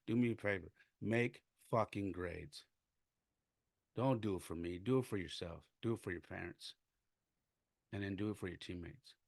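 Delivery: very soft, low-key coaching; subdued retold appeal. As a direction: recount the advice quietly, letting it sink away. Retells soft appeal to players.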